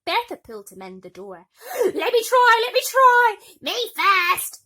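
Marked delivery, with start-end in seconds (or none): 1.6-3.4 very high, loud eager squeal. Acted. Bertha grabbing slipper. 3.6-4.6 high, loud whiny wail. Acted. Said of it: "wailed Gertrude".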